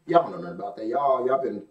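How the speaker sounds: low, soft sarcastic jab at the room; teasing aside. Real, not acted. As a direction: needle them quietly, tongue in cheek.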